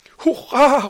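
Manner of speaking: put-on high, loud child's cheer; shouting hurray. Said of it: "råbte Camomilla". (højt, jublende barnerråb)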